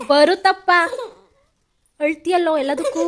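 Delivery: teasing with a laugh. Real, not acted. Mother laughs at crying son. (ನಗುತ್ತಾ ರೇಗಿಸುವುದು)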